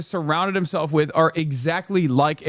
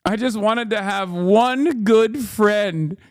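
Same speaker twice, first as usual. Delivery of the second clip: higher, drawn-out hammed-up whine; mock whiny Greco; 6 semitones higher, much slower than usual. Mocking the aide who wanted a friend, slow high whine. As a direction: stretch every word in a mock pout. Quoting Greco's pleading to reporter.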